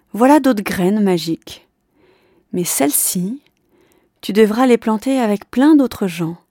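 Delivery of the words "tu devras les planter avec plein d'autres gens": plain reading voice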